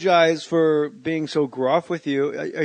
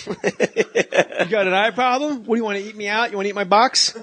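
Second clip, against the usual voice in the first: higher, hammed-up bully taunt; mock bully; 5 semitones higher than usual. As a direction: taunt like a silly schoolyard bully. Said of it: "The bully where shit".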